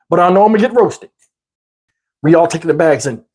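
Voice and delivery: ordinary talk, nothing marked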